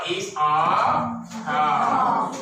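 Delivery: slow, drawn-out model sentence. Shown as a demonstration. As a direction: spell it out slowly for the children. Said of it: "So we say what?".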